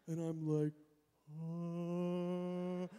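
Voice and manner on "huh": long, drawn-out dazed "huh"; mock slow-motion confusion; slow-motion reaction. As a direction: stretch it out on one held note. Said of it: "then my life slowed down".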